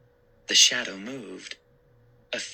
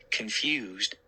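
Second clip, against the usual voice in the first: usual speaking voice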